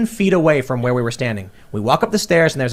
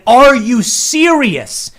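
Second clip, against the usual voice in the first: very slow, high, louder disbelief; incredulous; 9 semitones higher, 7 dB louder, much slower than usual. Retold or imitated. To the restaurant staff, slow stunned disbelief. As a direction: stretch it out, stunned and higher.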